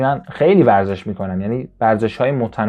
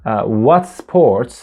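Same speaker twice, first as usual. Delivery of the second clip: very slow, louder phrase; slow demo; 5 dB louder, much slower than usual. Shown as a demonstration. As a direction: stretch it out for learners. (آهسته و کشیده)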